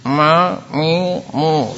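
ma mi mu said through the nose; wrong nasal ma, mi, mu. Shown as a demonstration. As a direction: push the sound into the nose on purpose. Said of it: "mengucapkan huruf itu dengan hidung terus".

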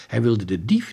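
ordinary delivery

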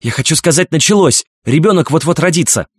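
высокий взволнованный голос роженицы; сообщи срочно, высоким тревожным голосом; тревожно. A narrator's reading. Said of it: "тревожно ответила мама". (взволнованно и высоко)

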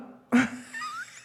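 high squealing laugh; shrieking laugh. Real, not acted. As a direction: burst into a shrill laugh. Reacts to the poem's joke.

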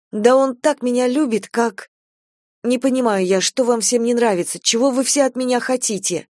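upset, near tears. A narrator's reading. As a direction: protest as if tears are about to come. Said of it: "Она заплакала". (взволнованно, на грани слёз)

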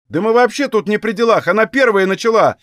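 high-pitched protesting shout; defensive, high yell; calling out. A narrator's reading. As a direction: shout your innocence from the crowd. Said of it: "крикнул кто-то из толпы".

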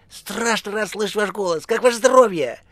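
high, gushing delight; joyful phone voice. A narrator's reading. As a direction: greet effusively in a high voice. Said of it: "радостно вскричала трубка". (радостно, восторженно, высоким голосом)

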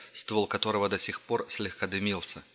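ordinary delivery, nothing marked

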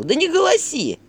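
barked order. A narrator's reading. Father silences the wailing mother, barking. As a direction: snap at her to stop wailing. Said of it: "рявкнул Матвей".

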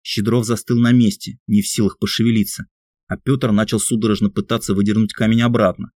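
ordinary delivery

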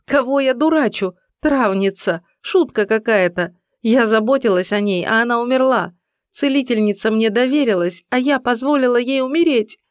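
громко, высоко, сквозь слёзы; кричи, виня себя; в слезах. A narrator's reading. Иден в слезах винит себя. (отчаянные слёзы)

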